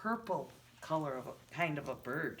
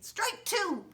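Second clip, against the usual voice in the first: umpire call; 14 semitones higher than usual. Retold or imitated. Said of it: "An umpire says".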